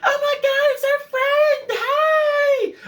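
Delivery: very high, loud, drawn-out greeting; squealing bachelorettes. Retold or imitated. Bachelorette group spotting their friend again, loud high shriek. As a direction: shriek with delight like drunk party girls. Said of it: "the same bachelorette group".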